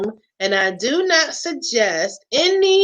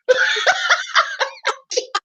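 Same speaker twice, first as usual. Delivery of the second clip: high laugh. Real, not acted. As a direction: giggle high and light. Laughs after outburst.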